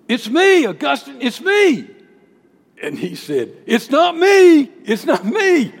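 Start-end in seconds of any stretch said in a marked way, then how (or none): 0.1-1.9 higher and louder calling a name; crying out. Acted. Woman calling out to Augustine, loud and high. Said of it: "she cried out". 3.6-5.7 much higher, louder, slow, half-laughing; hammed-up panicked denial; frantic denial. Augustine fleeing the woman, high hammed-up denial. Augustine denying, running away.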